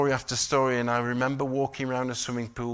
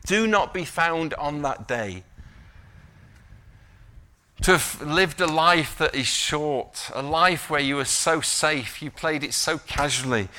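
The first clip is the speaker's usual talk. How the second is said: forceful preaching warning; 5 semitones higher, 8 dB louder than usual. Real, not acted. Preacher warning against a life played safe, forceful preaching. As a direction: drive the warning home, stretching key words.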